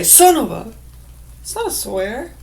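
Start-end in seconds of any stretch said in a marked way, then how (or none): 0.0-0.7 forceful but nearer normal pitch; louder, milder cut-off oath; toned-down demo curse. Shown as a demonstration. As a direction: punch the unfinished curse without shrieking. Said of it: "Now, if I just say".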